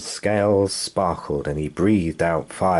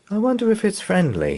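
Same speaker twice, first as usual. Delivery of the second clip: put-on child voice; 12 semitones higher, faster than usual. Son's voice.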